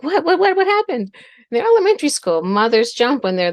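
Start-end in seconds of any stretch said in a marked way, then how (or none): 0.0-1.1 high alarmed what; jumpy, alarmed questioning; retold startled mom, high pitched. Mom startled, asking what happened.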